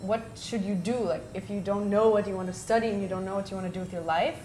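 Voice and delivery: normal talking voice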